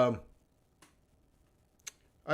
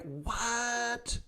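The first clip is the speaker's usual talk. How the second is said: much higher, softer, drawn-out what; mock astonishment; 9 semitones higher, 8 dB quieter than usual. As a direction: let the disbelief hang. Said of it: "And you're like".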